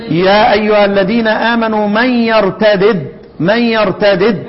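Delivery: slow chant-like reading, يرتدد as yartadid; idgham undone. Shown as a demonstration. Teacher gives Nafi and Ibn Amir's reading, يرتدد as yartadid. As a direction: sound both dals separately in يرتدد. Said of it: "يقرآن ايه؟".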